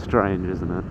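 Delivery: usual speaking voice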